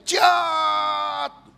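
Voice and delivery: a high sudden yelled cry; possessed scream. Retold or imitated. Preacher imitating the possessed man's shriek in church, high sudden yell. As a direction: yelp it out like a possessed man. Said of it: "Dia teriak".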